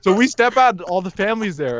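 ordinary talk

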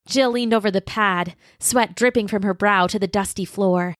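usual speaking voice

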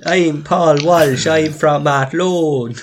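very slow, put-on Athlone accent; irish midlands accent. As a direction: introduce yourself as a local, hammed up. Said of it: "Terrible impression".

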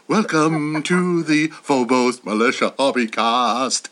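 very low and slow hero intro; deep Batman voice. Shown as a demonstration. Rehearsing the podcast intro as Batman. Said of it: "deep Batman voice".

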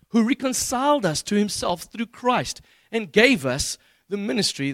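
slow, emphatic pulpit reading; scripture reading. Acted. Preacher reads Corinthians to the congregation, slow and emphatic. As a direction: read deliberately, leaning hard on key words.

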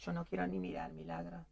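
very soft, low aside; quiet, fearful murmur; dreading. Acted. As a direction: shrink away in a hushed low voice. Woman dreading to watch the fight.

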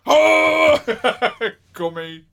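loud, slow thrilled cry; excited shout. Real, not acted. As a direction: call out the name in delight. Excited about Harry.

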